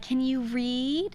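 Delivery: imitating a tester quizzing a kid; mock slow questioning. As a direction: ask it slowly, as if to a small child. School testers question a small child.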